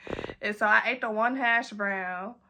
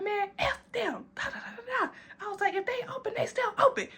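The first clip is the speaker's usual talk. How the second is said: much higher, softer tipsy sing-song with laughs; a silly tipsy mock chant; giggly drunk voice; 10 semitones higher, 4 dB quieter than usual. Back in the car about the staff, high giggly tipsy voice.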